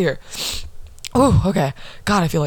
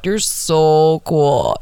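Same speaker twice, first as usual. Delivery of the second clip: slow, louder sarcastic drawl; mock them with fake admiration; 4 dB louder, much slower than usual. Mocking the bully's post.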